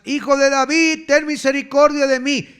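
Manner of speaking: high, loud, insistent shout; crying out harder. Acted. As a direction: plead at full voice over those hushing you. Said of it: "él clamaba mucho más".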